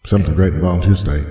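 loud, fast, booming announcer drop of "Something great in a volunteer state". Acted. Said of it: "That was pretty awesome".

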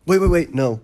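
high, hurried stalling; playful retold alarm; mock panic. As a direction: imitate someone begging for a moment. Acted stalling before the cut.

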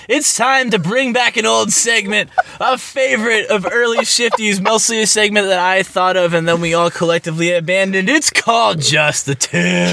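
high, bright show-host announcement; segment announcer. Acted.

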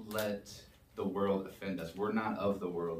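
usual speaking voice

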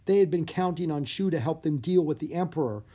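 plain reading voice

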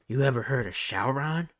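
lightly voiced creepy old man asking; a hint of a sly old man pitching Sauron. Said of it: "He literally fucking says it like that".

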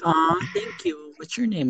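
high, soft girl imitation; girl's voice. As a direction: coo it softly in a high mock-girl voice.

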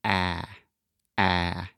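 wide open ah sound; saying ahh. Shown as a demonstration. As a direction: mouth open as far as possible, Ah. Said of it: "and then just say".

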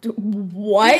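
loud "what?" through a laugh; loud amused shock; laughing disbelief. Real, not acted. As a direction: burst out, baffled and amused. Stunned by the odd word.

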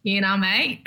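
thick Australian accent; Aussie accent. Shown as a demonstration. Asked to show off her accent, strong Aussie accent. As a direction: give a taste of strong Aussie. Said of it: "Can we get a little taste?".

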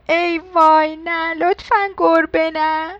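loud, high, panicky mouse plea; frightened wail. Acted. Poor mouse sees a cat and begs, frightened. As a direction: cry out in storybook alarm.

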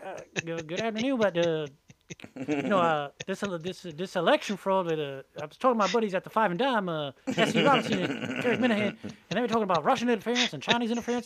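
lightly put-on yokel voice chattering to a radio host; Hillbilly Jim. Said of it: "I was speaking like Hillbilly Jim".

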